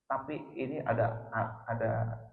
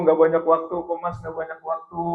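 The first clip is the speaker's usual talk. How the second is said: hammed-up wifely grumble, higher and louder; complaining; 4 semitones higher, 9 dB louder than usual. Wife to husband about no time, mock complaining. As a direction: mimic a wife nagging her husband. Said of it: "Tadinya ngeluh".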